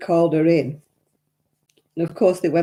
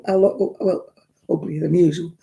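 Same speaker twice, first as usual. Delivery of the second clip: usual speaking voice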